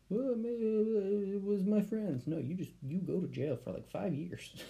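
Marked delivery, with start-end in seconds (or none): 0.1-2.3 whiny excuse voice. Retold or imitated. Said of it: "There's no".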